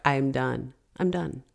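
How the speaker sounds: soft, defeated mutter; defeated murmur. Retold or imitated. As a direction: give up under your breath. Exhausted shutdown on drive home.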